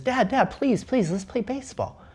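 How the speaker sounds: put-on high whiny little kid begging; begging little boy. Boy begging his dad again for baseball: high pleading child.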